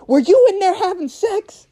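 high-pitched mock interrogation; pissed-off mom. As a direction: demand an answer like a scandalized mother. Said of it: "she's a pissed off mom".

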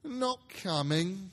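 slow, deflated "Not coming"; sudden disappointment. Acted. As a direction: let the spirits sink. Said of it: "with a sudden declension in his high spirits".

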